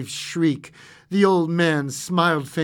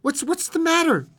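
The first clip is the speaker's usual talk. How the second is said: high, loud startled stammer; alarmed; 13 semitones higher, 6 dB louder than usual. A narrator's reading. Husband startled awake by wife's cry, alarmed. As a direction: jolt awake in a panic. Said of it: "He started up in alarm".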